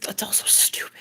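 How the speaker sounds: whispered. Real, not acted. Emotional confession, muttered to self.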